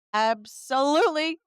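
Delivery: retold with exaggerated readiness; emphatic agreement. Caller agrees to a callback, loudly emphatic.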